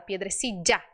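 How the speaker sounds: "piedrecilla" with LL as a cut DJ; low "piedrecilla", a tap-like dj at LL; struck, cut DJ. Shown as a demonstration. Teacher likening DJ to a cut beat, "piedrecilla". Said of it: "batida, cortada".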